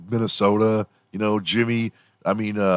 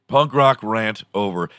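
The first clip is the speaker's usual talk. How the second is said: usual speaking voice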